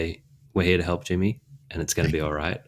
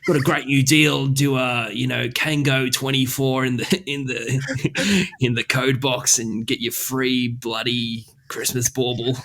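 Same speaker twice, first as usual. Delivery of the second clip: hammed-up broad Aussie ad voice, higher; mock Aussie commercial pitch; Australian ad read; 6 semitones higher, slower than usual. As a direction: sell the deal like a true blue Aussie radio ad. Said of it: "lean into the Australian side of things".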